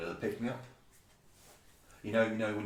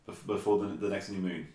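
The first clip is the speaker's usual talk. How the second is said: usual speaking voice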